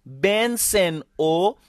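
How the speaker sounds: slow, word-by-word list for repeating; measured language-teacher drill; slow model pronunciation. Shown as a demonstration. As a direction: say each pronoun slowly, spaced out for learners. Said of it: "Répétez avec moi".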